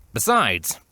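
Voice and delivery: plain reading voice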